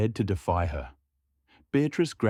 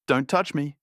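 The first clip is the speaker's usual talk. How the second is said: high, sharp shriek, a bit higher; mock shriek; 7 semitones higher than usual. A narrator's reading. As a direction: shriek it out as if struck. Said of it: "Beatrice shrieked".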